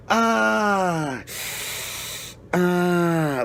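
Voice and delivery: high drawn-out Peter Griffin groan. Retold or imitated. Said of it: "like Peter Griffin's".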